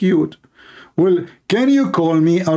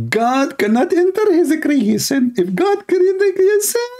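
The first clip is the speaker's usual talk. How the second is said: high, squeaky parody of the debater; mocking opponent's voice; 14 semitones higher than usual. Mocking a rival debater in a high squeaky voice. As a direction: pitch it way up to mock his argument. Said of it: "He said".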